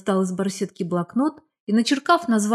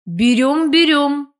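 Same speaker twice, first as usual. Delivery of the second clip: raised, louder cheerful call; sudden interjection; 7 semitones higher, 6 dB louder, slower than usual. A narrator's reading. Old classmate butting in at the shop, sudden call. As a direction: cut in suddenly from behind, higher and louder. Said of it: "неожиданно раздалось за моей спиной".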